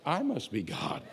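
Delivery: soft, haughty voice of the cat; soft, snooty cat; smug cat voice. Retold or imitated. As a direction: play the cat, self-satisfied and aloof. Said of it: "you know what your cat says?".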